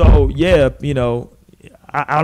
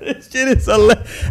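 usual speaking voice

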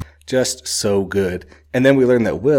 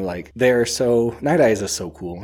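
usual speaking voice

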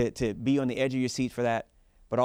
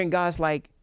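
preaching; 4 semitones higher, 4 dB louder than usual. Acted. Preaching at full force.

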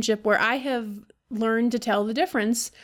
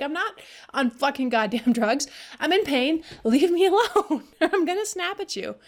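higher, half-laughing protest at the boss; mock exasperated protest; 5 semitones higher than usual. Employee in pain snapping at supervisor, laughing exasperation.

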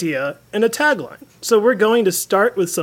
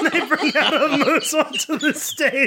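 ordinary talk

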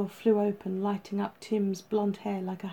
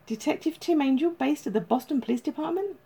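high, bubbly telephone saleswoman manner; chirpy agency caller; 7 semitones higher than usual. A narrator's reading. Agency caller rings detective with news, chirpy. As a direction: lift the pitch, bursting with good news.

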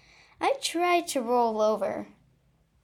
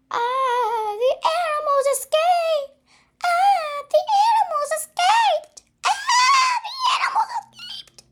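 from loud to shrieking, climbing higher; rising panicked shout; 8 dB louder than usual. Acted. Unknown voice shouting the animals escaped, building to a scream.

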